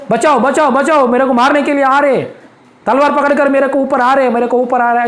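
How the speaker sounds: high, urgent mock screaming; imitated cries for help. Preacher acts out the troubled man calling for rescue, high panicked cries. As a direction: cry out as if fleeing a sword in a nightmare. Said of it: "ऐसा ही चिल्ला रहे".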